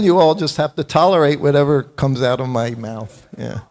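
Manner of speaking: ordinary talk, nothing marked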